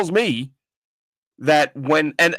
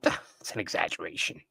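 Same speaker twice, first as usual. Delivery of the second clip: low, much softer, offhand brush-off voice; dismissive shrug; 9 semitones lower, 12 dB quieter than usual. Retold or imitated. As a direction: wave it away like it's nothing. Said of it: "And they go".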